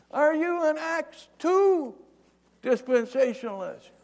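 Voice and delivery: high, loud acted holler; sustained long-distance shout; yelling. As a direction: call up to a man far above. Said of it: "He yells up at him".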